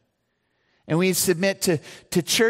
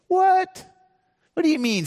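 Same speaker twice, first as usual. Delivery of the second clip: sudden much higher squeak of disbelief; mock surprise; 14 semitones higher than usual. Pastor voicing a puzzled congregant, a high mock What. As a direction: play the baffled listener. Pastor voices a listener's doubt.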